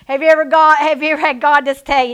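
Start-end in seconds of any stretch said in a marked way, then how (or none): none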